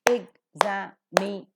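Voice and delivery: each syllable of "examination" said apart, with pauses. Shown as a demonstration. Teacher counting out syllables for students, "examination" in separate pieces. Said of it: "a pentasyllabic one".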